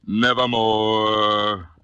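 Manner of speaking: very slow, drawn-out doom; raven's final reply. A narrator's reading. The raven refusing to leave, very slow and ominous. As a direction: stretch it like a final verdict. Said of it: "Quoth the raven".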